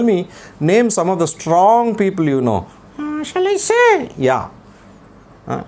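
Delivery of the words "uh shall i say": put-on puppet boy voice. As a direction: play the cheeky little boy puppet. Puppet child voice.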